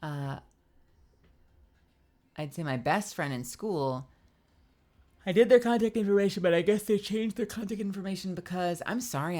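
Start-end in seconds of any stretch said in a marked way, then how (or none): 5.2-8.8 yawning. Real, not acted. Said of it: "I'm yawning".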